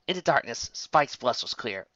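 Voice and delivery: plain reading voice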